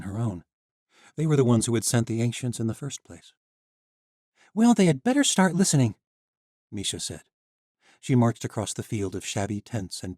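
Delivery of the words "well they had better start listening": put-on high, firm young woman; determined heroine. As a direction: say it with resolve. Misha angry at the knights.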